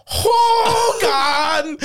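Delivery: high barked call of a name; hammed-up officer shouting for Hogan; mock sitcom villain calling out; Nazi officer yelling. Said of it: "the one lead Nazi literally goes".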